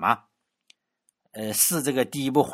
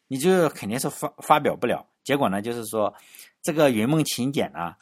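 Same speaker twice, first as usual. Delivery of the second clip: ordinary talk, nothing marked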